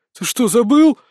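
loud, threatening demand; menacing. Acted. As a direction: bark it at him. Hero menaces the bully.

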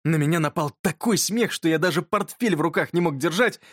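ordinary delivery

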